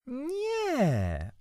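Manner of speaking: very high, stretched-out refusal; stubborn. A narrator's reading. Boy contradicting his aunt, stubbornly. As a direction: dig in with a long high no. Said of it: "odparłem uporczywie".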